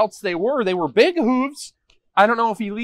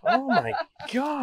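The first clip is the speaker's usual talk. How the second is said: laughing through the words, slowed down; 5 semitones higher, slower than usual. Real, not acted. Host laughing at the forgotten neighbor, real laughter. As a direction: cracks up mid-phrase.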